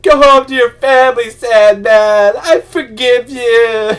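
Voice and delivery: high, loud, slow hammed-up weepy forgiveness; mock tearful whine. Mocking a whiny Spider-Man forgiving Sandman, hammed-up weepy wail. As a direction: overplay a sobbing saint forgiving his enemy. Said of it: "Spider-Man isn't all about crying and whining".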